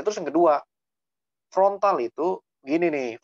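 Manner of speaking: ordinary delivery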